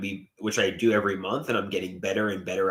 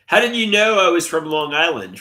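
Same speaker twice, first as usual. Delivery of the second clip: louder, higher demanding question; 6 semitones higher, 7 dB louder than usual. Real, not acted. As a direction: call it out to chat. Asks chat twice, first louder.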